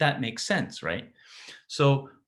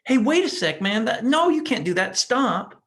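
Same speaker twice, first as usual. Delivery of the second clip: much higher, louder startled protest; alarmed inductor; 10 semitones higher, 6 dB louder than usual. Acted. Inductor protesting the sudden push of charge, alarmed yelp. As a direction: cry out like a part shoved without warning. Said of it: "And I'm going".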